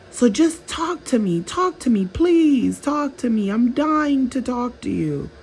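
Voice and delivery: high, needy begging voice, well above her own; pleading narcissist. Acted. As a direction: plead like someone desperate for your attention.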